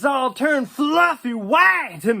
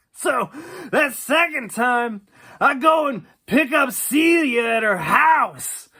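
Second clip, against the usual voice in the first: ordinary talk, nothing marked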